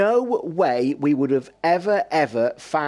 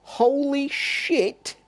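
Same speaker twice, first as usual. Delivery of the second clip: drawn-out, much higher holy shit; stunned exclamation; 11 semitones higher, much slower than usual. Real, not acted. Streamer reacting to a murder programme, stunned drawl. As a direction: let the disbelief stretch the words out slowly.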